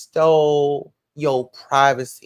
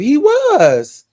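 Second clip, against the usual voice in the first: high, loud cry of agreement; loud squealed confirmation; emphatic agreement; 10 semitones higher, 8 dB louder than usual. Real, not acted. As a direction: shout it out, drawn high. Exclaimed agreement with a comment.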